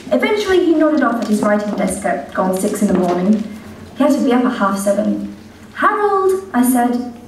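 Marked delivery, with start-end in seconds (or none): 5.7-6.5 higher, sing-song mother calling her son; doting mother voice. Acted. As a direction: coo at your grown son like a fond mother. Mother voice in told story.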